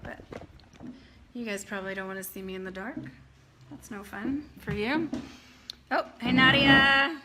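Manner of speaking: normal talking voice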